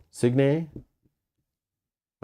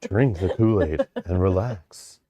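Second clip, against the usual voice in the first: cult leader. Pretend. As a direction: lull them into a trance. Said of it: "You sound like a cult leader".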